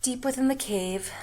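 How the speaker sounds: usual speaking voice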